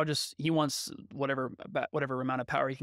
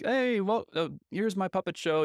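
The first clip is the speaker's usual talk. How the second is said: higher, louder, big announcer welcome; showman; 6 semitones higher, 4 dB louder than usual. Retold or imitated. Podcaster imitating Stromboli presenting his puppet show, showy. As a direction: play to the crowd like a showman. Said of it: "he's this great showman".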